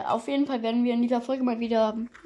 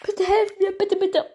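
higher, mock desperate begging; high pleading voice; 9 semitones higher than usual. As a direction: beg in a high squeaky voice. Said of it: "Und dann sagen die genau das".

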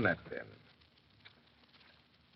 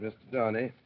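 ordinary talk

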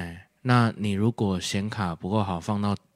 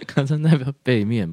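usual speaking voice

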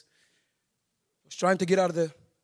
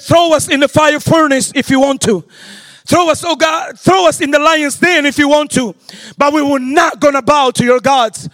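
rising preacher's proclamation, louder; preaching; 5 semitones higher, 13 dB louder than usual. Acted. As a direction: declare it boldly like the Hebrew boys' defiance.

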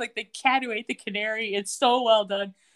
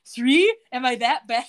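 startled rise on Three, a touch louder; surprised; 6 dB louder than usual. Retold or imitated. Allison reacting to three sessions a week, surprised. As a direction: caught off guard, a little hurt. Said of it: "her eyes get big".

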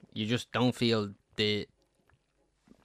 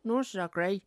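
echoes the friend's line in a higher voice; mimicking his friend; 9 semitones higher than usual. Mocks his co-host by parroting him in a higher voice. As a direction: lightly parrot what he just said. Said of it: "See, I like to mimic people".

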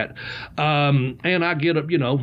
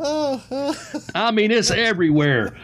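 laughing; 6 semitones higher than usual. Real, not acted. Laughing after spraying water.

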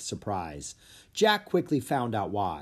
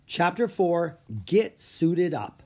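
slow, deliberate title announcement; chapter title; 6 semitones higher, 3 dB louder, slower than usual. A narrator's reading. Narrator announcing the chapter title slowly. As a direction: read the chapter heading slowly and clearly.

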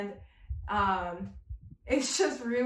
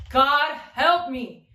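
emphatic outcry; 4 semitones higher, 9 dB louder, much slower than usual. Real, not acted. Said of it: "God help me if I talk".

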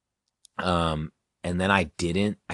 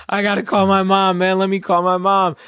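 high, loud mock wail; panicked yelling; 13 semitones higher, 10 dB louder than usual. Stoned teen begging his friend to call mom, panicked yelling. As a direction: plead at full volume like a freaked-out kid. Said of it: "I'm just, like, yelling".

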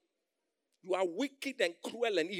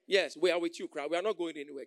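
ordinary delivery

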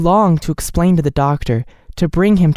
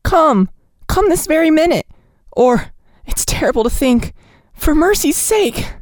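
desperate urging. A narrator's reading. Nellie urging the sleepy doctor, high and frantic. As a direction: press him hard to come now.

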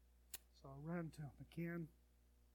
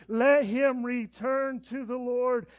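slow proclaiming; 8 semitones higher, 19 dB louder, slower than usual. Acted. Slowed proclamation.

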